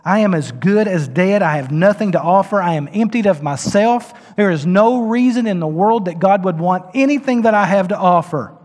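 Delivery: loud, rising, pleading; desperate confession. Real, not acted. Preacher voicing the broken sinner, loud and pleading. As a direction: confess with nothing left.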